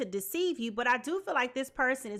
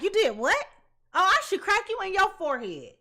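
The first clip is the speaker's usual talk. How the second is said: louder, higher indignant threat; mock angry threat; 6 semitones higher, 5 dB louder than usual. Reader imitating the wronged partner's angry threat, louder and higher. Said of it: "That's how angry this person is".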